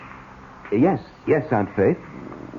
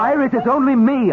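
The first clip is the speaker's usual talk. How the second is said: high, loud reassurance; urgent call; 14 semitones higher, 4 dB louder than usual. Acted. Husband shouts to reach the panicking girl. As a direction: shout over her panic. Man shouts to calm her.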